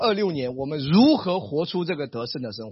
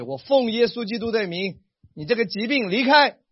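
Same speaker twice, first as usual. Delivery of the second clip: commanding prayer; 6 semitones higher, 5 dB louder than usual. Shown as a demonstration. Commanding sickness to leave.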